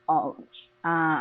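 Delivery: normal talking voice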